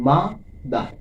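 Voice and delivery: "মা", short pause, "দার"; two separate beats on মা and দার; syllable by syllable. Shown as a demonstration. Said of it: "দুইবার স্পর্শ করছে হাতে".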